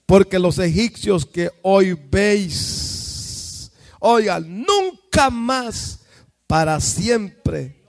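lento y fuerte, proclamando la Escritura; lectura solemne. Real, not acted. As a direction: declama cada palabra con peso. (proclamando a Moisés lento y solemne)

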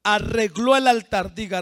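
ordinary talk, nothing marked

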